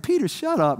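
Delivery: high-pitched exasperated mock rebuke; mock scolding. Preacher cutting off over-talkative Peter, mock scolding. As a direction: playfully tell him to shut up.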